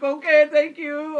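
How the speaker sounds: high, loud, slow imitation Romanian accent; mock-wailing thanks in a foreign accent; distraught Romanian lifeguard. Lifeguard accepts being sent away, imitated in loud high Romanian accent. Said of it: "He's the Romanian lifeguard there".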